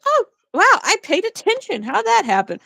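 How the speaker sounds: high swooping mock surprise that settles down; mock amazement. Mimicking followers who accidentally listened, mock-amazed. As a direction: play a viewer astonished at themselves. Acting out viewers amazed they paid attention.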